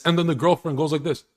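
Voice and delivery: usual speaking voice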